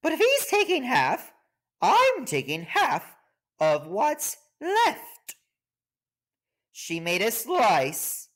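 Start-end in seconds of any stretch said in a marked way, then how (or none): 0.0-5.4 a squeaky high sniff of contempt; hippo's scornful thought. Acted.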